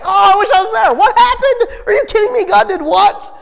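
high, laughing, loud mock disbelief; squealing, giggling amazement; excited disbelief. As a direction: act out someone who missed the miracle.

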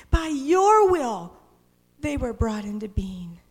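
loud, high cry of worship. Acted. Preacher voicing the elders' loud cry of praise. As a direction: proclaim it loudly to God.

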